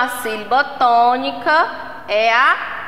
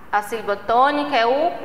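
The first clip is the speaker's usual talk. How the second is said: usual speaking voice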